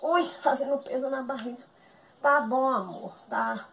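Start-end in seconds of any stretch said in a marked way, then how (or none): none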